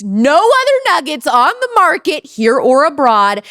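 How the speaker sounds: high, loud pitchman hype; excited. Acted. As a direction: sell it big and bright. Hyping product in ad.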